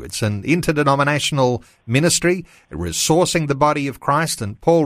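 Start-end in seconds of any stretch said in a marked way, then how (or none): none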